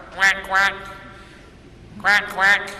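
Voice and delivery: loud, high quacking imitation; bright imitated duck call.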